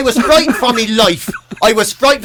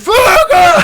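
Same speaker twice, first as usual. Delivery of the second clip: higher, louder laughing yell; mock shout; 9 semitones higher, 4 dB louder than usual. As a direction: bark it out, half laughing. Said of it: "He said".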